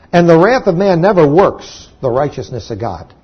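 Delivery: ordinary talk, nothing marked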